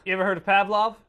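loud, high shouting at the beasts; hammed-up angry yell. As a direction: bark it like a furious miner. Prospector yelling at creatures.

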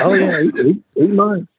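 mock cocky rookie bragging; imitating the braggart. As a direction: puff up like a know-nothing rookie. Wannabe insisting.

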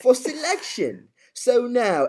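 very high, drawn-out mimicked voice; Alfie. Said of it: "And he goes".

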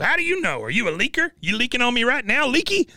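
put-on high, taunting old man's squawk; mocking Cotton. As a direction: jeer at him like a nasty old coot.

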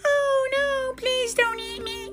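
tiny, very high pleading goat; very small voice. A narrator's reading. Littlest goat begs the troll for mercy, tiny pleading voice. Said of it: "in his very small voice".

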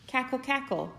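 put-on playful hen clucking for the children. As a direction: cluck it out like a laughing mother hen. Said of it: "she clucked and laughed".

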